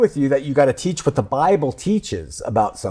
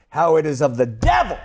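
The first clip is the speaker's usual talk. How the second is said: hammed-up Southern pulpit condemnation; mock Southern sermon with swooping pitch; Southern preacher. Host acting out a pastor on the devil, Southern preacher accent. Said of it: "always do this in a Southern accent".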